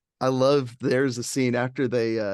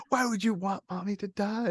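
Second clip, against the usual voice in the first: put-on soft child's voice; 7 semitones higher, 5 dB quieter than usual. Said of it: "He's like".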